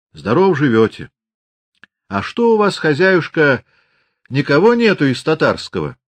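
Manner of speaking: hearty Cossack greeting. A narrator's reading. Prokhor enters greeting loudly.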